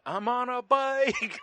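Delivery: high slow vlogger announcement. Pretend. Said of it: "disappointed you didn't do a video".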